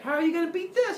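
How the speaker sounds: high, teasing little voice; taunting gnat. Acted. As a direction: crow in a tiny insect voice. Gnat goddess taunting Zeus.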